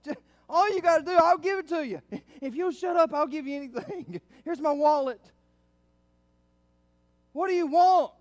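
high, loud, desperate pleading; exasperated parent. Acted. Frustrated parent to wailing toddler, hammed-up exasperation. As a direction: act out a parent at the end of their rope. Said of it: "you just wanna say".

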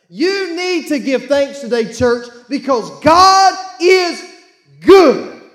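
slow, loud, rising to a shouted good; preaching shout. Real, not acted. Preacher to the church, building to a loud shouted climax. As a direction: drag it out and blast the last word.

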